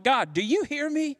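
high, imploring questions to God; crying out to God, pitched high; acted lament. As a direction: plead upward, desperate for an answer. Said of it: "they often reflect this sentiment like".

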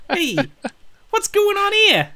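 put-on high, loud Brooklyn kid voice; Brooklyn accent. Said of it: "He sounded like a Brooklyn kid".

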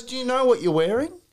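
high retold challenge; questioning young lad. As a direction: imitate a lad quizzing someone. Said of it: "this young lad goes".

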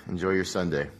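ordinary delivery

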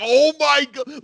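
high, loud astonished cry; thrilled disbelief. Retold or imitated. Viewer reacts to a brutal on-air put-down, retold high excited disbelief. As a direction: exclaim like jumping out of your seat. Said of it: "stand up from your chair".

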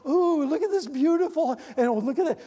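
high-pitched imitation of gushing admiration; mock awe, pitched well up; mock oohing and ahing. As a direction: ooh and ah like an impressed dinner guest. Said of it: "He wanted Jesus to walk in and ooh and ah".

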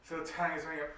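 usual speaking voice

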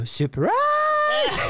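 very high, squealed Surprise; hammed-up falsetto surprise; mock surprise reveal. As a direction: shriek it like a gleeful reveal. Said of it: "se sanoo".

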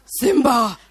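big, loud Mufasa-style call; loud, commanding call; lion father calling. Acted. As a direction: boom the name out like a father from the clouds. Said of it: "his dad goes".

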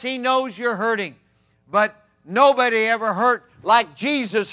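loud, high, fervent preaching; preaching at full force. Acted. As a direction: lift the congregation with rising fervor.

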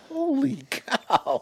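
high, drawn-out astonished cry; holy cow shock. Retold or imitated. Watching a huge shark glide by, drawn-out amazed cry. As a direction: gasp it out slow in disbelief. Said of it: "I'm like".

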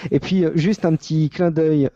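ordinary talk, nothing marked

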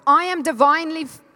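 punchy, full-voiced faith declaration; with conviction. Shown as a demonstration. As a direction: say it like you mean it. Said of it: "like you mean it with faith".

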